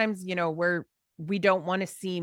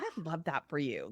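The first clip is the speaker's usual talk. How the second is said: much softer, lower aside; quiet, lower "I love that for you"; soft approval; 5 semitones lower, 10 dB quieter than usual. Retold or imitated. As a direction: murmur it fondly. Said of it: "And I was like".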